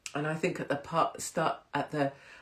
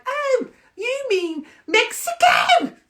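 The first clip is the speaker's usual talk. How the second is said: shrieked, hammed-up strong accent; very high, loud and drawn-out mock accent; James English; 11 dB louder, slower than usual. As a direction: shout it in an exaggerated northern English accent. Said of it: "his accent is strong".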